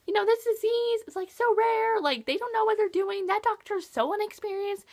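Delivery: a hint of a defensive mother; indignant mom. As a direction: play the mother brushing off the doctor. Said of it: "her mom's just like".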